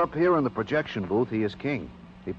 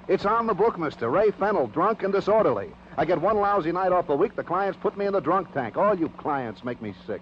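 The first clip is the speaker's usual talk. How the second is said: angry outburst; 6 semitones higher than usual. Acted. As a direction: lash out at the cop over the arrest. Suspect snaps back at cop.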